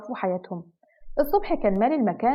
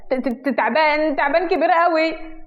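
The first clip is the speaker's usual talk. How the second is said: slow, stuttering fright that climbs higher; terrified stutter; 5 semitones higher, 3 dB louder, slower than usual. Acted. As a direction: stammer out the danger in fear. Terrified boy points at snake.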